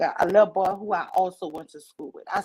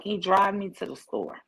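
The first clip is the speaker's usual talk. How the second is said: usual speaking voice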